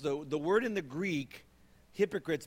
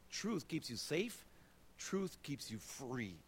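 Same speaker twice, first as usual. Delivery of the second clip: tender conviction; 7 dB quieter, slower than usual. Real, not acted. Sermon conviction, slow soft.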